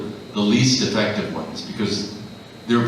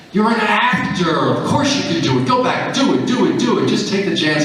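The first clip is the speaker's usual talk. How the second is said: high, loud self-encouragement; urging himself on, high and loud; self pep talk; 11 semitones higher, 3 dB louder than usual. Retold or imitated. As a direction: pump yourself up, louder.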